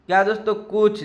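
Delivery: ordinary delivery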